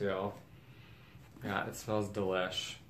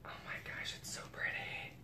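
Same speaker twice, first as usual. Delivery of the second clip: awed whisper; 14 dB quieter than usual. Real, not acted. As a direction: breath-only whisper of admiration. Overwhelmed by the bag.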